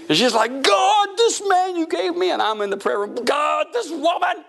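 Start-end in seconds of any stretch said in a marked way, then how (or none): 0.6-2.3 high-pitched mock complaint to God; exasperated wife praying. Wife's angry prayer acted out. 3.2-4.4 high, slow mock wail to God; drawn-out comic lament in prayer; exasperated husband praying.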